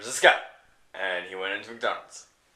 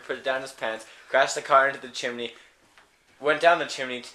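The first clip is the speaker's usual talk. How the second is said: ordinary delivery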